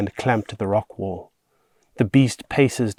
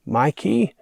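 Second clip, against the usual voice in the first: higher, questioning slurred call; sleepy slur; 6 semitones higher than usual. A narrator's reading. Girl woken by the boy, slurring his name. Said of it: "Annie slurs".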